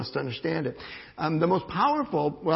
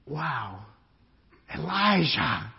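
usual speaking voice

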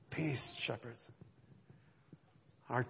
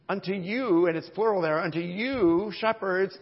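you said loud and pressed; stress on you; 3 semitones higher, 9 dB louder than usual. Real, not acted. Pastor stressing that the promise means each listener, louder. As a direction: lean hard on you, unto you. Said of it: "I emphasize that".

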